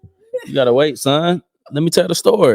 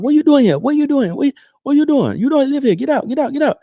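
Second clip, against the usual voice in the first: high-pitched, frantic mock confrontation; faster than usual. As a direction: mimic a landlord chasing out an intruder, pitch way up. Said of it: "He was like".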